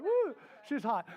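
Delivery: high, drawn-out admiring exclamation; smitten whoop. Retold or imitated. Husband recalls meeting his wife, smitten whoop. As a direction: whoop at first sight of her. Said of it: "I was like".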